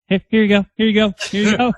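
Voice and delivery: high, coaxing offer; nervous appeasing voice; mock placating. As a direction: soothe a hungry boss with food. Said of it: "you're like".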